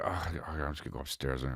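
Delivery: very soft, low, hurried mumble; quick, hushed retold excuse; muttered retreat. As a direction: mumble it fast as you slink off. Slinking away from the film.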